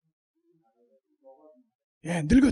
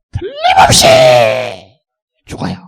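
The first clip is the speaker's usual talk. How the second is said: emphatic shout; 14 semitones lower, 18 dB louder than usual. Real, not acted. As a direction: proclaim it with full force. Preacher's emphatic declaration. (확신에 차서 외침)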